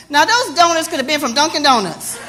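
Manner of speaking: normal talking voice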